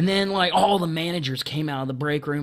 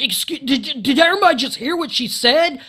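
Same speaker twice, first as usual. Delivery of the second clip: high, loud public appeal; making a scene in the store, loud outrage; raised voice; 8 semitones higher, 6 dB louder than usual. Retold or imitated. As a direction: call the room in as witnesses. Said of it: "raising my voice".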